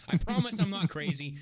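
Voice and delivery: loud bursts of mock begging; mock desperate pleading. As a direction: plead your sanity, hammed up. Acted plea not to be locked up.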